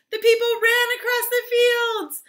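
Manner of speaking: high, loud storytelling; excited narration. A narrator's reading. Storyteller as townspeople run to them, excited shout.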